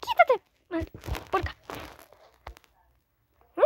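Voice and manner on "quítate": shrill rising yell; high shout. Acted. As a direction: yell it much higher. Escalating shoos at Piggy. (grito agudo)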